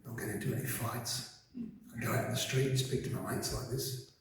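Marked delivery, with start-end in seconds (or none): 1.5-4.1 soft, put-on Australian bloke accent. Shown as a demonstration. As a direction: talk to mates in an Aussie accent. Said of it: "speak like an Australian bloke".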